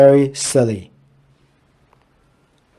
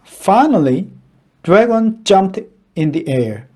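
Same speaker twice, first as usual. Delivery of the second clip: usual speaking voice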